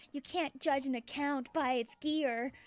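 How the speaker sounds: ordinary talk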